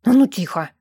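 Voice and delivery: restrained growl of command; growled order. A narrator's reading. Man restraining a struggling girl, growling. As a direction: growl a short order at her. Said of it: "рыкнул Брент".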